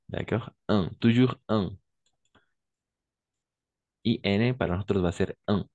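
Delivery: usual speaking voice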